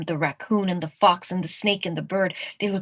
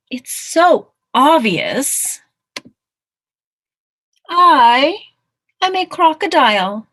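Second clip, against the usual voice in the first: loud, higher-pitched, drawn-out proclamation; triumphant declaration; 7 semitones higher, 10 dB louder, much slower than usual. Acted. Mouse announcing his new identity, loud and triumphant. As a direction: hold the "I", then announce it grandly.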